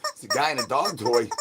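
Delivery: ordinary talk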